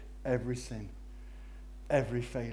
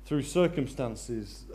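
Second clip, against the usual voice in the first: usual speaking voice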